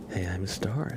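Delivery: ordinary talk, nothing marked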